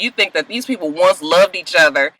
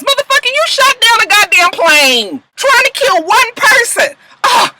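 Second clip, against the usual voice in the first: indignant outburst; 13 semitones higher, 3 dB louder than usual. Real, not acted. Scolding the character.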